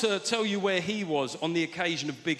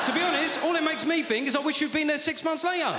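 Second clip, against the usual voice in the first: exasperated outburst; 10 semitones higher than usual. Retold or imitated. Shouting at mate Wayne over a beer, exasperated. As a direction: blurt it out, fed up with his mate.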